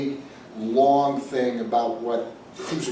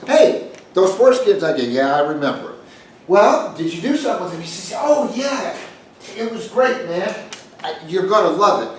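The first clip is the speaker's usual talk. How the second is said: eager taxidermist; 6 semitones higher, 5 dB louder than usual. Retold or imitated. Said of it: "and he says".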